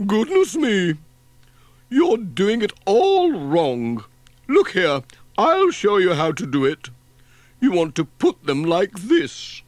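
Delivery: high, loud, know-it-all lady voice; bossy spinster. A narrator's reading. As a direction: take over like a fussy busybody. Voices Miss Hubbard.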